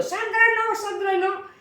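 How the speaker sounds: put-on very high, urgent cry of the child's name; shouting no. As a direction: scream at the child to stop. (grito agudo y desesperado de madre)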